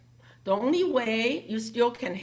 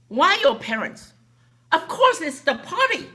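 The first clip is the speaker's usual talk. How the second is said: loud, high retold scolding with a laugh in it; angry scolding; 6 semitones higher, 8 dB louder than usual. Miss Wang scolding Tina for the wrong answer, loud and high. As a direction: scold her like she's lost her mind. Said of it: "Miss Wang got very angry".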